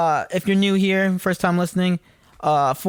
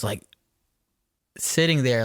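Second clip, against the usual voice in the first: normal talking voice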